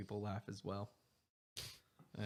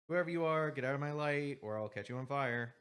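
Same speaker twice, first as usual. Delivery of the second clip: annoyed threat; 5 semitones higher, 8 dB louder than usual. Acted. GM voicing annoyed NPC.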